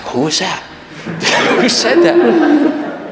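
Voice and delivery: high, slow, laughing who said that; amazed at your own words; a high, drawn-out, laughing who said that; mock surprise. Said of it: "I was like".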